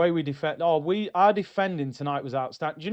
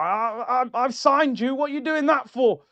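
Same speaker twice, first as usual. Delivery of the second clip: high-pitched mock protest from an aggrieved manager; indignant manager; 7 semitones higher than usual. Voicing Simeone scolding Berta.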